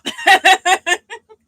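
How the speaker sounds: bursting laugh, high and loud. Real, not acted. Laughs at comment.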